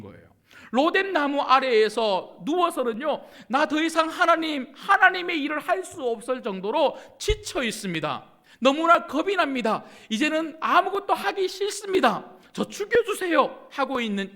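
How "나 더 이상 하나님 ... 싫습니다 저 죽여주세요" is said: loud, high-pitched desperate plea; raised, pleading voice of a worn-out prophet; despairing Elijah. Acted. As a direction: cry out to God that you can't go on. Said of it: "죽여달라고 하는 이 길".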